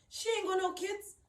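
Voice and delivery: raging at her mother's unfairness, loud and high; angry outburst. Real, not acted. Furious rant at her mum.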